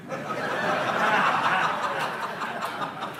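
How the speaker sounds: airy laugh, almost silent; real quiet laugh at the joke; soft breathy laugh. Real, not acted. Laughs after the punchline.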